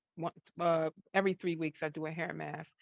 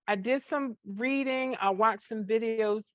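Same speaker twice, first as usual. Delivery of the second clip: ordinary talk, nothing marked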